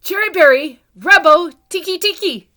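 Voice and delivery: very high, loud, gasping for breath. A narrator's reading. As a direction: choke the words out between gasps. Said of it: "he gasped".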